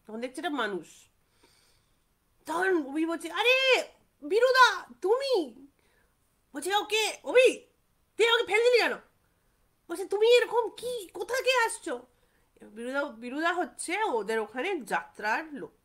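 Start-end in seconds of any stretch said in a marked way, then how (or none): 3.3-5.3 high, loud and slow amazed cry; loud surprise. Retold or imitated. Abhi recognising Biruda on the road, a loud amazed cry. 6.8-8.9 high, loud reproach; indignant scolding. Retold or imitated. Biruda scolding Abhi for knocking him down, a high loud reproach. 10.1-11.9 high, loud bewildered asking; loud astonished question. Retold or imitated. Abhi asking Biruda where he is coming from, loud and astonished.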